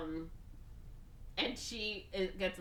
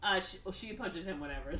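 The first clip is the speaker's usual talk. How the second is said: normal talking voice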